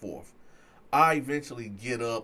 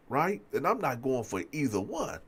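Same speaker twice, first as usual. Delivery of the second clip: normal talking voice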